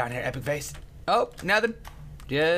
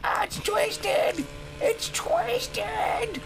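very high, drawn-out wail; mock distress; much slower than usual. Gamer reacting to the game, mock falsetto wail.

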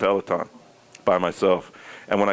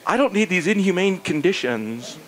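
higher, self-important protest; mock indignation; 6 semitones higher than usual. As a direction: complain like an offended professional. Acted indignation at team conditions.

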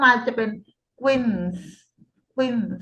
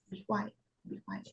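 hushed, softer be quiet; 2 semitones lower, 15 dB quieter, much slower than usual. Shown as a demonstration. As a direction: say quiet as if hushing. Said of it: "quiet แปลว่าเงียบ". (พูด be quiet เบาๆ)

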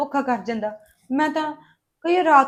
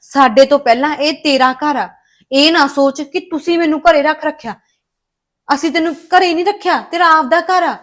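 firm insistence; 7 dB louder than usual. Acted. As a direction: insist firmly, leaving no room to argue. Insisting this is her home.

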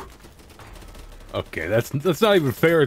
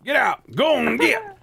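exasperated shouting; 10 semitones higher than usual. Real, not acted. Chasing players out.

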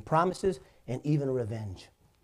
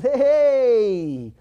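startled greeting; 10 semitones higher, 11 dB louder than usual. Retold or imitated. Officer's startled reply.

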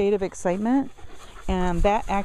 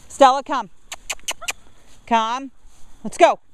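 calling the dog; 7 semitones higher than usual. Real, not acted. Calling dogs to come.

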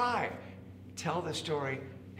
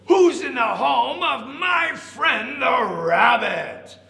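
slow, high, very loud challenge; elephant bellow; 10 semitones higher, 10 dB louder, slower than usual. A narrator's reading. Elephant calling into the log, slow loud bellow. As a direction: bellow it out, stretching every word. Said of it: "the elephant said".

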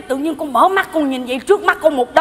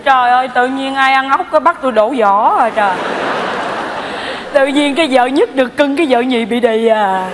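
indignant lament; 3 semitones lower, 4 dB louder than usual. Acted. Wife complains of unfair treatment.

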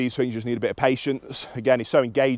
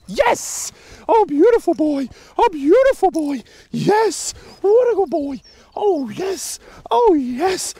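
very high, cooing delight; excited dog praise; 4 dB louder, slower than usual. Real, not acted. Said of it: "vocal inflection, praise, physical praise".

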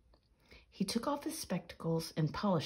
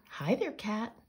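high, lively calling out; hailing; 9 semitones higher, 5 dB louder than usual. A narrator's reading. Peter Painter greets the passing animals, hailing them. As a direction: call to each one, pitched well up. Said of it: "hailed Peter Painter".

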